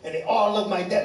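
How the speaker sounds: ordinary talk, nothing marked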